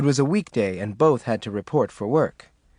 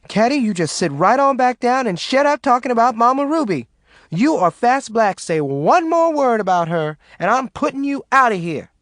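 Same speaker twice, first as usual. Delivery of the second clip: angry threat; 10 semitones higher, 4 dB louder than usual. A narrator's reading. Maureen threatens the friends.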